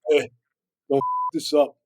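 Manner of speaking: bad Canadian accent; hammed-up Canadian accent. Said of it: "I'm gonna do a real bad Canadian accent here".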